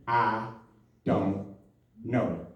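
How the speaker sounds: very low, soft, one word at a time; hushed, halting, comic deliberation; deliberate admission. Retold or imitated. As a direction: drop the voice and pause after each word. Acts out deliberate answer, word by word.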